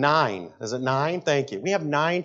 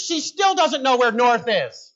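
ordinary talk, nothing marked

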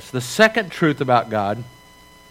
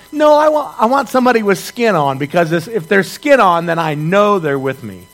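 put-on high, loud childish whine; the preacher mimicking everyone's inner child, high and loud whining; whiny demand; 4 semitones higher, 5 dB louder than usual. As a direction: whine like a scared kid who wants someone there. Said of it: "it's like".

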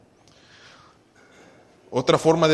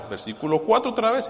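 ordinary delivery

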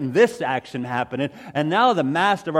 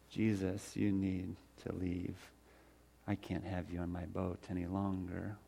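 hushed plea; 8 semitones lower, 16 dB quieter than usual. Acted. Ashamed fisherman quietly pleading with Jesus to leave. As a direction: beg him gently to go away.

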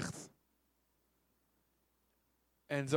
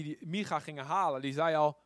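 ordinary delivery

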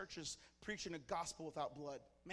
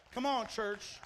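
usual speaking voice

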